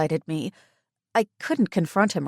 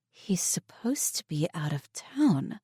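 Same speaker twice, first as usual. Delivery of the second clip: whisper; 4 dB quieter than usual. Acted. Inner thought in hiding.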